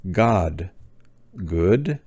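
ordinary talk, nothing marked

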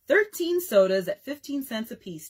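usual speaking voice

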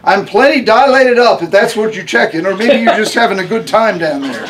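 a tough, no-nonsense patient giving orders; Harmony's voice. A narrator's reading. Harmony teasing the doctor.

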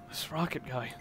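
put-on brooding young hero thinking aloud; troubled inner monologue.

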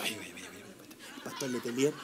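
hushed, breathy gossip about the pastor; gossiping whisper. Retold or imitated. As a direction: whisper the rumor like church gossip. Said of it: "yo escucho los rumores". (susurro de chisme)

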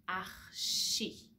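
archi with sh, not k; French archi. Shown as a demonstration. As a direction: say archi as arshi. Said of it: "The first one is in she".

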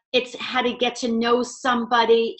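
ordinary talk

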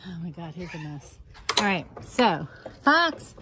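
usual speaking voice

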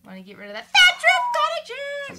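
very high silly squeak; squeaky high voice. Pretend.

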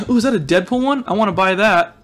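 usual speaking voice

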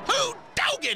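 high whooping hillbilly cheer; excited whoop. Acted. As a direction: yell it out in delight. Celebrating a lucky shot.

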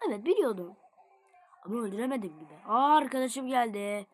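ordinary delivery

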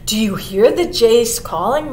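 ordinary delivery, nothing marked

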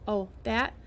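ordinary delivery, nothing marked

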